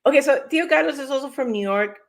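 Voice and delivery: normal talking voice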